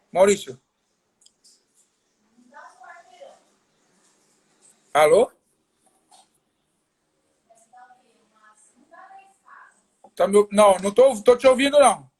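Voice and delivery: calling out, trying to be heard; to someone hard of hearing. Real, not acted. Call breaking up, can't hear.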